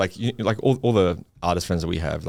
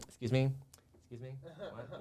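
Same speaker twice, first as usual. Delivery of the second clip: very soft, higher questioning voice; imitated puzzled query; 7 semitones higher, 14 dB quieter than usual. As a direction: mimic a puzzled attendant under your breath. Acting out uncomprehending flight attendant.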